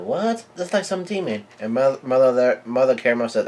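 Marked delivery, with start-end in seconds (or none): 0.0-1.5 high-pitched imitated outcry; mock yell. Kids yelling at the demon face, high mock yell. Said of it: "kids are yelling out".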